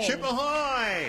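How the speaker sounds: high, slow, drawn-out hail; echoed sailor's hail. Retold or imitated. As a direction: echo the call high and long.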